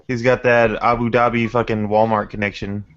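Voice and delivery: usual speaking voice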